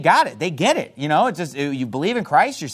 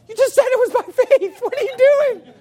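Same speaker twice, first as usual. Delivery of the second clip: much higher, stunned disbelief; incredulous; 3 dB louder than usual. Retold or imitated. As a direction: squeak it out in disbelief. Incredulous at contradictory answer.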